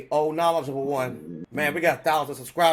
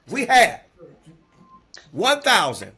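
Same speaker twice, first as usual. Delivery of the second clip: excited shout; 4 semitones higher, 7 dB louder, slower than usual. Real, not acted. Host announcing the milestone to viewers, excited shout. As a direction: jump up high and loud, thrilled.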